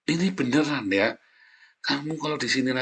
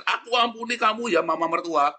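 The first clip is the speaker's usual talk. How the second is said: high-pitched in-law forgiving mother-in-law; playful high-voiced forgiveness; acted daughter-in-law; 10 semitones higher, 3 dB louder than usual. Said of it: "mertuanya sama mantunya sama-sama di sini".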